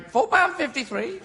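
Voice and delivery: high, slow parrot voice; talking bird. Acted. Shoulder bird calling out prices, high parrot voice. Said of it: "a bird on your shoulder going".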